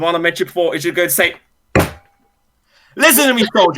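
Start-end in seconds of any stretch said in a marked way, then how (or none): 3.0-3.8 a drill sergeant snake ordering a recruit. Acted. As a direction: bark it like a hard drill instructor. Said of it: "the drill sergeant snake".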